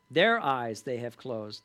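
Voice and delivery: slow, weighted "Their eyes they have closed" with "Their" leading; deliberate, slowed reread; emphasis. Shown as a demonstration. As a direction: stress "Their" and slow the whole phrase. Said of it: "the point that I wanna emphasize".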